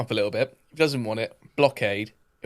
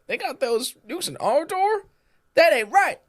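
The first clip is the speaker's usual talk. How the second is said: hawkish American; 4 dB louder, slower than usual. Retold or imitated. Guest imitating angry Americans about the nukes, mock indignant. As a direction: play an outraged war-loving American.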